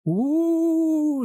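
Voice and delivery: long high sliding sci-fi "ooh"; theremin-style ooh. Retold or imitated. Reviewer mimicking fifties sci-fi soundtrack, spooky wailing ooh. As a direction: imitate an eerie theremin, rising and held high. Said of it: "sci-fi kind of thing that's going on with the theremins".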